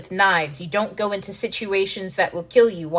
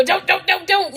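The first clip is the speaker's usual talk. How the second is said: panicked pleading; 11 semitones higher, 4 dB louder than usual. Retold or imitated. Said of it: "it's gonna go".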